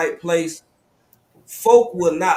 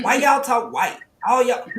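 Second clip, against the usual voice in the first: puzzled challenge; 7 semitones higher, 3 dB louder than usual. Retold or imitated. Retold puzzled challenge to cousins.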